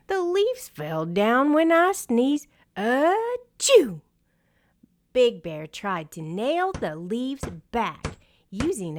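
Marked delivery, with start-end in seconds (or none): 0.1-4.0 loud high achoo; big sneeze. A narrator's reading. Bear sneezes loudly.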